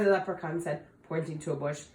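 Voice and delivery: plain reading voice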